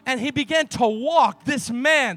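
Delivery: loud, driving sermon delivery that builds to a shout; full-force preaching. Acted. Pastor to the congregation, telling the healing in a loud preach. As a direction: preach it to the rafters, pushing every line.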